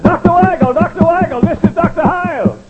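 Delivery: high, loud call through a door. Retold or imitated. Preacher calling through a closed door, high and loud.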